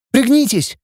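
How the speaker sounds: high-pitched warning at normal volume; urgent gasp. Acted. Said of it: "выдохнула она". (выдохнуть высоко, тревожно)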